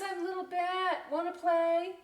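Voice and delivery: put-on high, eager kid voice; high eager little bat. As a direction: ask hopefully to join. Little bat asking to play.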